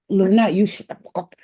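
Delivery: loud, annoyed snap at a pet; snapping at the cat. Real, not acted. Owner snaps at noisy cat Luna, loud and annoyed. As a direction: shut the cat up, fed up. Yelling at the cat mid-talk.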